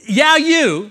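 YOU high, loud and drawn out; emphatic. Acted. Preacher showing how John addresses believers, emphatic. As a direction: hit YOU hard, pitched right up. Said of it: "that's how he's saying it".

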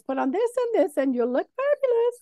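high, lilting fashion-adviser voice; playful, high-pitched flamboyance. Retold or imitated. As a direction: gush like a camp stylist.

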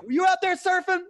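very high, loud put-on voice, same delivery; mock stoner voice. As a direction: repeat the beach drinker's line the same. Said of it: "the delivery is the same".

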